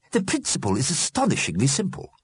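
hint of an eager enthusiast; lightly voiced keen explainer. A narrator's reading. Mister Fletcher explains his invention, enthusiastic.

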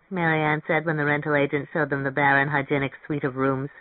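ordinary delivery, nothing marked

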